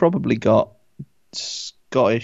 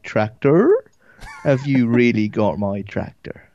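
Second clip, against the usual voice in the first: broader Devon accent; slower than usual. Shown as a demonstration. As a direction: drag out a thick southern Devon accent. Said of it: "the further sort of south you get".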